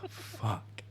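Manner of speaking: a soft whispered curse under the breath. Retold or imitated. Gamer annoyed by a screaming kid, whispered curse. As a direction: whisper it, defeated.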